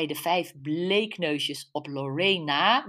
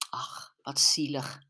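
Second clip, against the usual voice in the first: pitying whisper; 4 semitones lower than usual. Acted. Mother pitying a sad life story, whispered slowly. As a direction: whisper it, full of pity.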